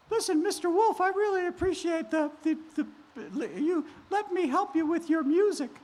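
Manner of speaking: high sweet princess voice; high girlish Cinderella. Acted. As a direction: greet him in a high girlish voice. Cinderella talks to the wolf.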